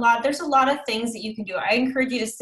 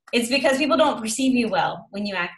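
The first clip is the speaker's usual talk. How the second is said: normal talking voice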